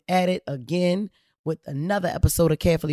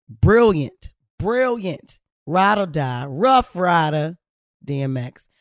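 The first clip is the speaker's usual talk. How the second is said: slow tribute; 2 semitones higher, 4 dB louder, slower than usual. Acted. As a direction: draw each word out slowly, louder on rough rider. Tribute to [name].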